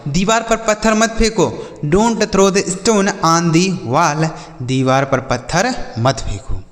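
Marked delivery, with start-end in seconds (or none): 1.9-4.3 each word said slowly and apart; slow clear example. Shown as a demonstration. Teacher reading an example sentence slowly to children. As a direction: dictate it slowly for young pupils. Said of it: "जैसे".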